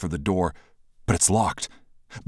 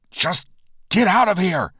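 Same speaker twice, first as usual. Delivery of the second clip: high, loud urgent shout; shouting at Bashira to flee; 13 semitones higher, 6 dB louder than usual. Acted. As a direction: yell it to send her away. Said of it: "I shout".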